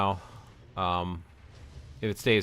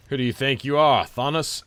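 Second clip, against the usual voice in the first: taunting; 4 semitones higher, 6 dB louder than usual. Real, not acted. Taunts game boss.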